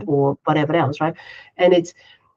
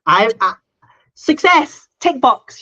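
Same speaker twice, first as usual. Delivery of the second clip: mock triumphant; 6 semitones higher, 6 dB louder, slower than usual. Inner triumph after school run.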